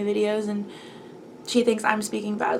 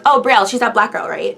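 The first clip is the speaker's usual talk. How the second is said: louder, dismissive gossip; gossiping girls; 8 dB louder than usual. Retold or imitated. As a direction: toss it off like casual gossip. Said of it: "they'd be like".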